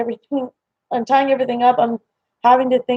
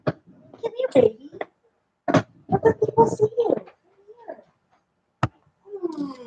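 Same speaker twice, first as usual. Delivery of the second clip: cooing to a pet; 10 semitones higher, 6 dB quieter than usual. Real, not acted. Owner showing her cat to viewers, soft high cooing. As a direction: coax a cat over in a small high voice.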